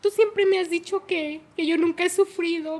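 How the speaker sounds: imitating a crying accusation; retold tearful reproach. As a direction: reproach a mother as if crying, pitched high. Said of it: "llorando, me dijo".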